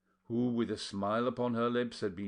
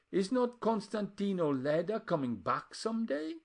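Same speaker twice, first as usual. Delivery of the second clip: put-on high scolding old aunt; 9 semitones higher, 5 dB louder than usual. A narrator's reading. Aunt Porreda retorts.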